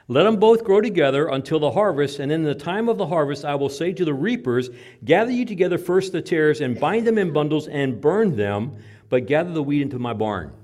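loud, ringing Bible recitation from the pulpit; forceful scripture reading. Real, not acted. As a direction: proclaim the verse to the congregation.

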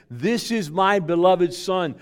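booming heavenly voice, slow. Acted. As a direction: proclaim it from on high. Voice from heaven quoted.

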